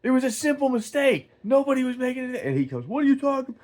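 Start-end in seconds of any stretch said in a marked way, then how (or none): 0.0-2.4 high, strained yell across a parking lot; re-enacted yell. Man confronting a rude customer at the gas station, yelling. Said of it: "I yelled, I was like". 2.8-3.5 high-pitched imitation of an arguing stranger; imitated indignant retort. Confronted customer answering the man yelling at him, indignant.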